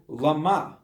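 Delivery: "lama" stressed on the second syllable, la-MA; slow, loud "lama" with the weight on MA. Shown as a demonstration. Rabbi showing another reading of a psalm word, second-syllable stress. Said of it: "Just put the emphasis on the second syllable".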